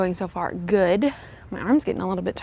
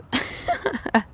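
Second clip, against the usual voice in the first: high breathy laugh; 11 semitones higher, 3 dB quieter than usual. Real, not acted. Laughs at own unfitness.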